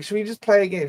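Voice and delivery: ordinary talk, nothing marked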